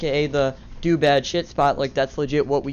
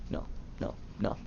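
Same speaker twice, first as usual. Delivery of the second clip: whispered, barely there no no no; hushed horrified whisper; whispered dread; 8 semitones lower, 15 dB quieter than usual. Retold or imitated. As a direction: breathe out your doom under your breath. Dread seeing friend caught too.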